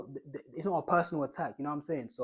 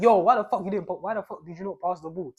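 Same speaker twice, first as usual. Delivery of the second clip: loud, higher angry shout; angry teammate; 3 semitones higher, 9 dB louder than usual. Pretend. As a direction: yell it in frustration. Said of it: "be prepared for the guy to be like".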